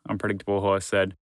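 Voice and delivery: plain reading voice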